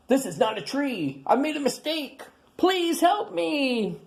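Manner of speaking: a tiny dinosaur begging for help; small scared creature. Acted. Tiny stuck high up.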